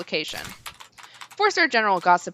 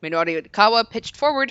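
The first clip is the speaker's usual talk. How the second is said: ordinary delivery, nothing marked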